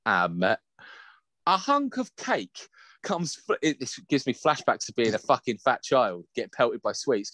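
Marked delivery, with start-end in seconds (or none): none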